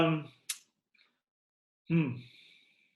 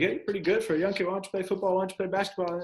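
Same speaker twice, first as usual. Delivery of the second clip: imitating older kids, higher and fast; 6 semitones higher, much faster than usual. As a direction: rattle off the praise like an older kid. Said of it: "kids be like".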